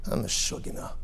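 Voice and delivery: a secret aside only you hear; inner whisper. Retold or imitated. Preacher voicing his private thought after the walk, whispered. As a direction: whisper it to yourself.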